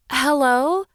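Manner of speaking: tiny, high and timid little seahorse; little seahorse calling into the cave. Acted. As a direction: squeak it out like a small sea creature. Small seahorse greets sea witch.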